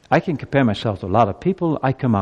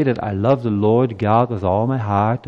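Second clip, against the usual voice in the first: ordinary talk, nothing marked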